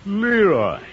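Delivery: high loud scolding "Leroy"; reproachful call. Acted. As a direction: stop the boy short. Uncle scolds nephew.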